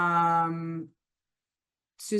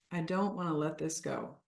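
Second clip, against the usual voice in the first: much softer, subdued repeat; soft reluctance; 4 semitones lower, 8 dB quieter than usual. Shown as a demonstration. Coach voicing attachment to food for the group, softly. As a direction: say it quietly, as if to yourself.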